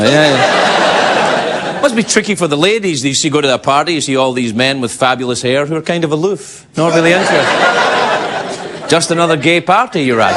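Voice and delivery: ordinary talk, nothing marked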